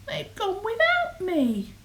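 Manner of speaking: high-pitched storybook wail; hammed-up lament; plaintive cry. A narrator's reading. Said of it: "And he cried".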